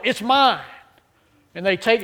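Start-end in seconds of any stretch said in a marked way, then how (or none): 0.0-0.6 put-on mild child yell. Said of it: "they yell".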